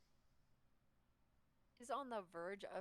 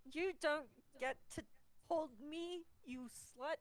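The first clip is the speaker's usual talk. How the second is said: higher, mock-angry drunken protest; flailing drunk; 11 semitones higher than usual. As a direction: lash out while being carried. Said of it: "flailing at him like".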